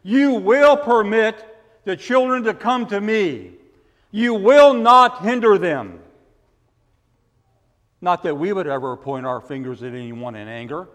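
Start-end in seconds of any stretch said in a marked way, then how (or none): 0.0-6.0 commanding Jesus. Retold or imitated. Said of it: "pointing his fingers at his disciples".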